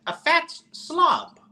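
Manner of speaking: put-on a slow, high Italian accent insult. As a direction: drag it out in an Italian nonna accent. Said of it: "in an Italian accent".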